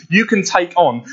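usual speaking voice

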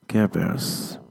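normal talking voice

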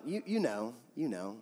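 quiet nagging accusation inside the head; insinuating inner voice. Acted.

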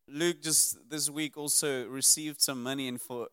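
normal talking voice

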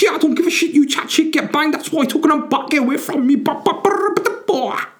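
put-on very high, loud squawking street-accent caricature; mock street accent. Islanders in a fight telling each other to get away, mock street accent. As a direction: rant shrilly in an exaggerated street accent, dissolving into gibberish. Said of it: "What sort of accent is that".

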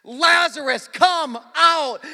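commanding high shout; booming summons, each word held; loud voice. Acted. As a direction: call out as if to raise the dead. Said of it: "called in a loud voice".

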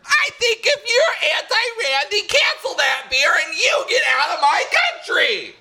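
shrieking shout. Acted. Escalating shouting match, peak.

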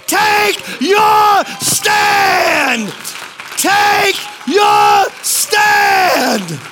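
high, shouted sermon command; fired-up pulpit shout; full-force preaching. Real, not acted. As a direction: preach it at the top, drawing out key words.

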